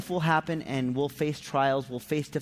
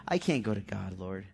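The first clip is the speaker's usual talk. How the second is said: low mock lament sinking softer; self-pitying; 7 semitones lower, 4 dB quieter than usual. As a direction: act a little helpless self-pity. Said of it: "this self-pity of".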